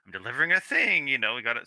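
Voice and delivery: normal talking voice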